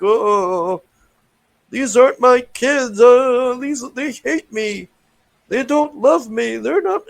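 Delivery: high whiny fake sob voice; mock crying. Mocking the witch crying over kids, whiny and high. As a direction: whimper it like a hammed-up crybaby. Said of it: "this is where she's crying".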